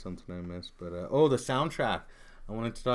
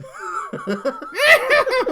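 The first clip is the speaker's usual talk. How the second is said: high loud whinnying laugh; neigh-like laughing fit; horse laugh; 13 dB louder than usual. Real, not acted. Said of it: "Now I sound like a horse".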